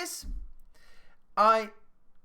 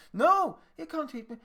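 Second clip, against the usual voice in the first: put-on higher, louder child's protest; indignant child; 6 semitones higher, 6 dB louder than usual. Child agreeing with the adult about being hit, indignant protest. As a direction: protest emphatically as a wronged child.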